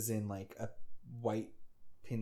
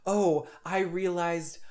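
higher, louder mock regret; lightly voiced princess; 7 semitones higher, 6 dB louder, faster than usual. Princess confessing love to the bandit, mock regretful. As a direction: gush it as a remorseful princess. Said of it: "so she's like".

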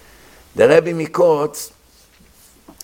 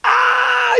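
very high startled scream; sudden high-pitched yelp; boy's scream. Retold or imitated. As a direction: shriek as you hit icy water. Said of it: "He screamed".

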